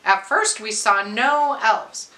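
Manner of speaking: ordinary delivery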